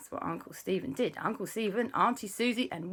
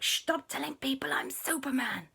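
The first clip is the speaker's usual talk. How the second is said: high, hissed whisper after a shush; urgent whisper; 8 semitones higher, 4 dB quieter than usual. Acted. Superman scolds auntie for telling, whispering. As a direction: hush her urgently.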